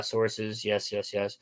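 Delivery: ordinary talk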